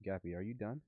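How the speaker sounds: normal talking voice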